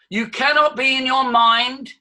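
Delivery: "cannot" hit high, "mind" held out; high, insistent statement; emphatic declaration. Shown as a demonstration. Repeats the claim for effect.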